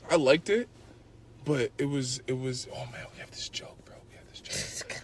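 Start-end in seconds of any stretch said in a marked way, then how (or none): 2.7-5.0 hushed, voiceless whisper; whispered aside. Real, not acted. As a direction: drop to a conspiratorial whisper. Hushed aside about a shared joke.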